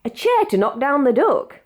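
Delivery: usual speaking voice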